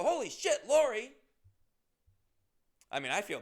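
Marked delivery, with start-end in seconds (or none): 0.0-1.1 much higher, louder startled yelp; shocked surprise. Retold or imitated. Comedian recalling recognizing a fan, high startled shout. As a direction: cry out in stunned recognition. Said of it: "I go".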